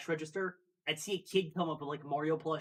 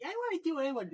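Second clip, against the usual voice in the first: put-on much higher whiny kid; child voice; 12 semitones higher, faster than usual. Kid begging to put the card in, high child voice. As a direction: whine like an eager child. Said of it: "The kid will be like".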